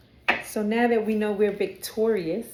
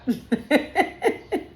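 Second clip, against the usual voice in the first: high-pitched burst of giggling; real laugh; 8 semitones higher, 4 dB louder than usual. Real, not acted. Reader stopping her own gushing, real laugh. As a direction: break up laughing at yourself. Laughs after "let me stop.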